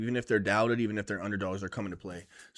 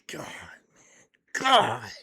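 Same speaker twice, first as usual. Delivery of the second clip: whispered groan; 2 semitones lower, much slower than usual. Real, not acted. Pained aside about Cody.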